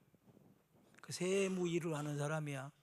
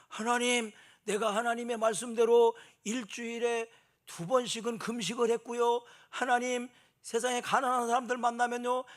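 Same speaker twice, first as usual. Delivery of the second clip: booming higher, louder show-off prayer; loud boastful prayer; 6 semitones higher, 10 dB louder than usual. Retold or imitated. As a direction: proclaim your good deeds to be overheard. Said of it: "큰 소리로 막 기도합니다".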